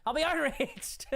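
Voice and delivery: high, drawn-out mock indignation with a laugh; laughing mock outrage. Streamer mocking his own reputation for outrage, laughing mock outrage. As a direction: stretch out the fake outrage, breaking into laughter. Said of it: "like, outraged all the time".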